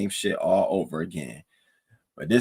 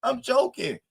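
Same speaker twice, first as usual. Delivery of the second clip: much higher, pleading and apologetic; 13 semitones higher than usual. Retold or imitated. [name] begging [name] that she was only joking, high mock pleading. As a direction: plead in her voice that it was a joke. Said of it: "So she's like".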